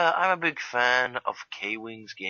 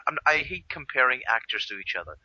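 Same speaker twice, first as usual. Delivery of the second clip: usual speaking voice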